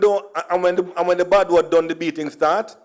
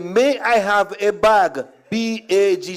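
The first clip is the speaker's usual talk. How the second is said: slow and clear; 3 semitones higher, slower than usual. Retold or imitated. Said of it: "I said".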